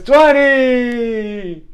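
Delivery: high, loud, long-held final number; bullhorn counting. Retold or imitated. Teacher finishing her count, loud drawn-out call. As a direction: hold the last count in a big call. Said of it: "she got her bullhorn".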